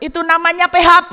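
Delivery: rushed, loud, laughing verdict; excited punchline. Real, not acted. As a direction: fire off the joke quickly. Punchline joke.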